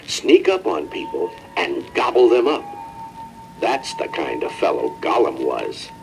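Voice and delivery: ordinary delivery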